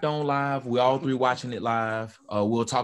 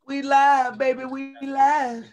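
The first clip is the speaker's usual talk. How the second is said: high, loud and drawn out; hype announcer call; 12 semitones higher, 4 dB louder, slower than usual. Acted. Show opening hype call.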